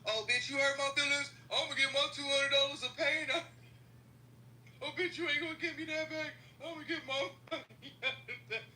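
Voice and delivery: sassy mock threat, laughing through the end; over-the-top revenge brag.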